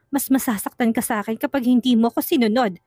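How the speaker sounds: plain reading voice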